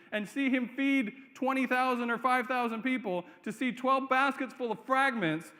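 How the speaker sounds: impassioned pulpit preaching; full-force preaching. Real, not acted. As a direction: drive the point home to the congregation.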